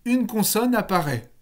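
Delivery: teacher mock-quoting a wrong rule; mocking students' claim. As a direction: mimic the naive textbook answer, drawn out. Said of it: "Beaucoup d'entre vous ont tendance à dire".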